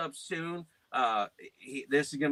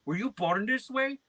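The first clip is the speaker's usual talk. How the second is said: high, put-on caller voice; imitated scam caller; 8 semitones higher than usual. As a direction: mimic the baffled scammer, pitched up.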